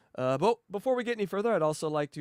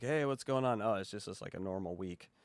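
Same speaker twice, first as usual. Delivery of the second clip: much lower and softer casual check-in; low-key; 9 semitones lower, 9 dB quieter than usual. Retold or imitated. Host on an ordinary week, low-key and quiet. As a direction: toss it off flat and quiet. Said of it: "by the tone".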